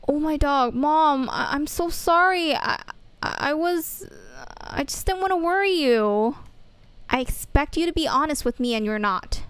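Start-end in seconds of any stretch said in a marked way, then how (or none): none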